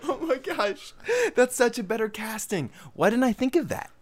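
high laughing voice. Real, not acted. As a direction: laugh through the words. Laughing at casting idea.

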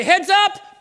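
high, loud call of Heads up; shouted warning. Retold or imitated. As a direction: yell it across the field. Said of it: "and they say".

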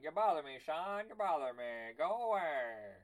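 put-on grumpy old mouse. Old mouse shooing the little mouse away, mildly cranky. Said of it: "the old mouse would be like".

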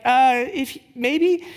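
hammed-up hesitant maybe; doubtful prayer. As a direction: hedge the prayer with a nervous laugh in the voice. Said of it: "I was like".

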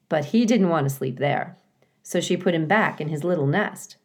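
ordinary delivery

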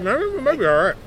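mouth full. Real, not acted. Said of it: "I'm sorry, I'm eating".

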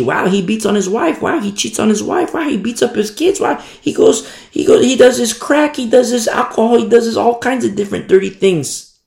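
rising, shocked wow on each line; mock shocked observer. A hint of disbelief at the hypocrite preacher's sins. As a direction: list his sins in feigned amazement. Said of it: "you look ... and you see".

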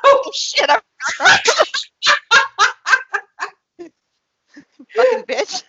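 high, laughing curse. Real, not acted. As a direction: curse through a laughing fit. Laughing fit after joke.

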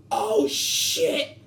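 high, drawn-out astonished exclamation, acted out; amazed outburst. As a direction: play a stunned, delighted discovery. New friend amazed at his name.

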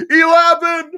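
high, loud yell of the wrong word; blurted yell. Retold or imitated. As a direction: shout the number by mistake. She yells the number instead.

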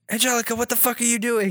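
high-pitched imitation of an aghast parent; mock parental outrage. Parents walking in on Angelica's mess, mock outrage.

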